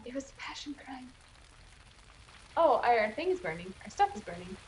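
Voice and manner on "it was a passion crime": a soft conspiratorial whisper. Real, not acted. Musing about the prisoner's crime, hushed whisper. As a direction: breathe it out like a secret.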